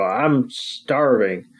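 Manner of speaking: a lightly voiced hungry Jessie, slow; mock hungry complaint, dragged out slowly; slow drawn-out I'm starving. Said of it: "and she, you know".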